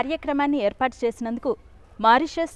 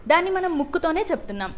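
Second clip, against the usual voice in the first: ordinary delivery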